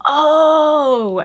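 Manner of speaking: loud, high, drawn-out "oh"; sudden recognition. Retold or imitated. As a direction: act out the moment it finally clicks. Retells recognising the performer.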